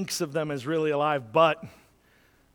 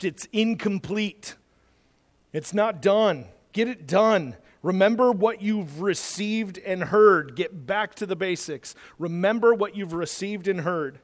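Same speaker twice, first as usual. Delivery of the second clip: normal talking voice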